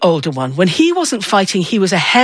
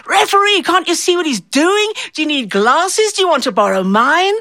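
much higher mock yell of an old lady at the referee; shrill-free but high, hammed-up heckling granny; imitating the yelling aunt; 8 semitones higher than usual. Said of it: "she turned into a yelling, screaming hoyden".

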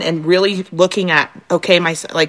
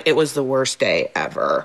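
put-on a slow, lower, mopey kid complaining; glum kid; 7 semitones lower, 5 dB quieter than usual.

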